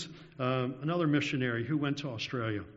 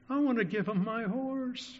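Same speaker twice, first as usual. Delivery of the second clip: mock tearful girl; 9 semitones higher than usual. Repeats girl's line, higher.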